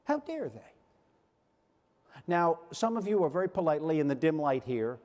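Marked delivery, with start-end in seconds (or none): 0.0-0.7 high, hushed indignant How dare they; outrage pitched up but held back; quiet disbelieving outrage. Retold or imitated. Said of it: "My reaction was".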